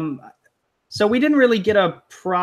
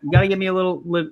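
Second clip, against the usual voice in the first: ordinary talk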